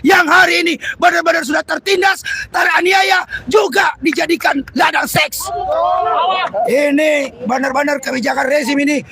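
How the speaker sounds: loud, rousing protest oration; rally the workers against the regime; fiery revolutionary speech. Real, not acted. Protest speech at full force. (pidato revolusioner berapi-api)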